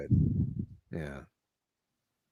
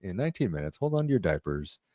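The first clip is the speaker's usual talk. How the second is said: pilot voice; 5 semitones lower, 5 dB quieter than usual. Retold or imitated. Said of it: "I sound like a pilot today".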